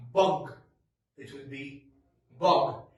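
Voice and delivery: Bug said with a short uh. Shown as a demonstration. Teacher modelling bug for the class. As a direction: say Bug clipped with uh. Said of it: "here I would say what".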